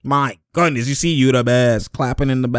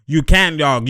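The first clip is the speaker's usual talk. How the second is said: normal talking voice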